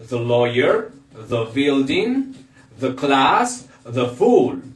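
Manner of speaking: "the" as thuh before each noun; pronunciation examples. Shown as a demonstration. Teacher giving examples before consonants, measured pronunciation demo. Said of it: "esto suena the".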